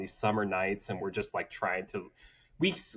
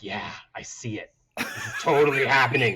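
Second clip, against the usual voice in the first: half-playful thrilled player, building up fast; mock eager player; 5 dB louder than usual. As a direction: lean in like an eager kid playing along. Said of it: "And I'm like".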